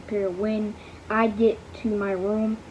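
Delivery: ordinary delivery, nothing marked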